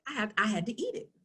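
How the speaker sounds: usual speaking voice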